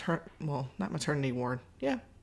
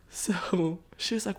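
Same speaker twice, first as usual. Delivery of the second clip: usual speaking voice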